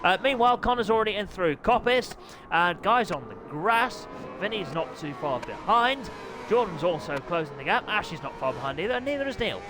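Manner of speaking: lively play-by-play commentator voice; upbeat live bike race commentary. Acted. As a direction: call the race like a TV motorsport commentator.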